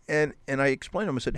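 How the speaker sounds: ordinary talk, nothing marked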